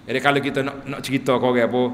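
ordinary talk, nothing marked

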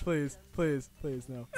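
low, soft repeated plea; quiet pleading. Real, not acted. Player pleading as the car struggles, quiet plea. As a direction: beg under your breath, fading.

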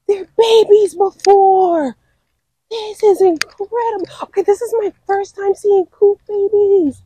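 high-pitched squeal of real delight; overexcited. Real, not acted. Birder spotting coot babies for the first time, overexcited. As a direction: gasp and gush, high and drawn out. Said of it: "I get overly excited".

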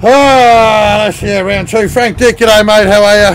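loud, high, bright hello to the chat; excited greeting. Real, not acted. Streamer greeting viewers as round two starts, excited and loud. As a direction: kick off round two with a burst of energy.

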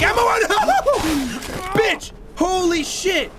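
high yelp of shock; startled laughing shout. Real, not acted. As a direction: cry out as it jumps you. Surprise attack mid-sentence.

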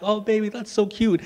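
put-on high, soft cooing to a child; doting dad. Dad admiring his daughters' castle, soft high doting voice. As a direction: coo over your kid's work. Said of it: "And I'm like".

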